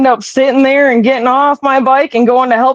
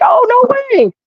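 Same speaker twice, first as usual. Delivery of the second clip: surprised; 13 semitones higher than usual. Retold or imitated.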